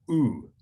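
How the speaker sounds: "oo" as in crude; vowel demo. Shown as a demonstration. Teacher demonstrating the last vowel, "oo" as in crude. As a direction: say a pure "oo", not you or uh. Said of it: "like the oo in crude".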